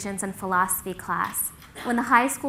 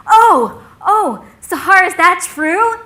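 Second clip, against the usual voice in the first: high, loud, dismayed oh; suddenly alarmed, pitch leaping up into the question; shocked realisation; 8 semitones higher, 11 dB louder, slower than usual. Retold or imitated. Logan learning he shouldn't touch his friend, shocked realisation.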